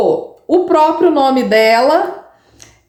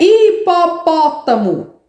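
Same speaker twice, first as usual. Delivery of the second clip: hipopótamo slowly split into syllables, a bit higher; sounding out syllables; 4 semitones higher, slower than usual. Shown as a demonstration. Teacher sounding out a child's word, slow and syllabic. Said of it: "uma letra pra cada sílaba".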